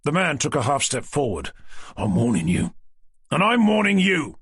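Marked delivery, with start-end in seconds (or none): 2.0-2.7 lower-pitched menacing mugger warning; low, cold street-thug warning; menacing threat. Acted. 3.3-4.4 high, loud furious counter-threat; angry shout. Acted. Detective throws threat back.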